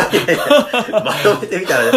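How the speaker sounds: laughing. Real, not acted. Reacting to a joke.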